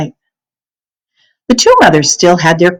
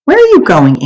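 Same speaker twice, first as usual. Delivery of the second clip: high, louder sudden question; startled question; 10 semitones higher, 4 dB louder than usual. A narrator's reading. Anne-Marie startled as mother heads for the door, high. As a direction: call after her in surprise. Girl calls after her mother.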